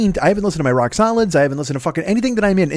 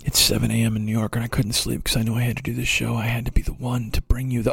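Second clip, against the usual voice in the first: low, hushed, solemn and grave; mock-serious emergency broadcast, breathy and low; Michael Moore impression; 3 semitones lower, 6 dB quieter than usual. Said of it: "I'm even doing it with more passion than he did".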